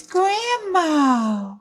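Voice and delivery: sweet granddaughter checking in; lightly voiced little girl. A narrator's reading. Little Red greets grandma.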